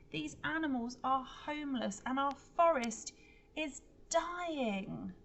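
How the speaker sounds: loud high impassioned speech; rally the crowd, loud and fierce; high, ringing protest voice; passionate protest. Acted. Greta confronting the giants.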